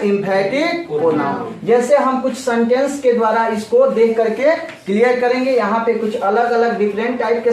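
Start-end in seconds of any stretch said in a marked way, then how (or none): 0.0-1.6 very slow, each syllable drawn out; slow drill answer. Shown as a demonstration. Teacher drilling the term very slowly. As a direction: spell it out slowly for the class. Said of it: "क्या होता है?".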